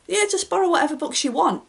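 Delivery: lightly acted, high-pitched casual offer; breezy offer. Telling a friend to borrow from the bookshelf, breezy. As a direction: toss it out high and carefree.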